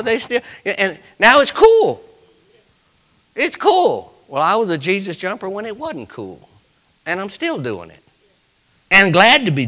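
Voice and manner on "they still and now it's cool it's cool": high, loud, drawn-out preacher's cry; preaching climax. Acted. Pastor building to a loud climax before the church. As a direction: lift it to a peak.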